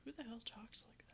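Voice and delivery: quiet unvoiced whisper; whispered in-character aside. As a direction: mutter it under your breath.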